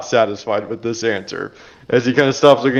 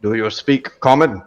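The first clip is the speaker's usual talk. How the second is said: gruff dwarf. Acted. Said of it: "says in a gruff voice".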